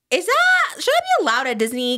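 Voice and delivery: high, incredulous question; shocked disbelief. Retold or imitated. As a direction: sound scandalized at the idea. Acting out her own shocked thought.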